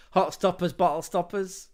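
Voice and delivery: giggling through hot stoppers; laughing pun. Real, not acted. Podcaster amused by his own pun, laughing. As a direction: laugh at your own wordplay.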